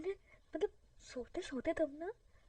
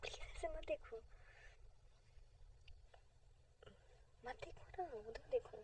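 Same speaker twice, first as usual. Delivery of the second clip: whisper; 12 dB quieter than usual. Acted.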